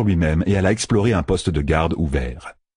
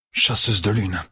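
a lightly voiced growl from Perrine. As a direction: growl "Chasseuse de lune" at her. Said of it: "grogna Perrine".

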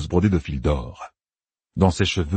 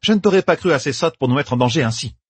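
a higher, biting rebuke; cutting remark; 8 semitones higher than usual. Acted. Yukiri scorning Meidani, a biting rebuke. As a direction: let the scorn drop. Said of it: "lâcha Yukiri".

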